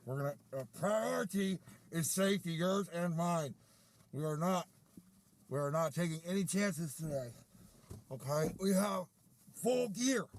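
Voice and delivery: loud, emphatic briefing in short bursts; mock safety commander. Commander briefs gentlemen on virus precautions, loud mock command. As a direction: address the troops with hammered-up authority.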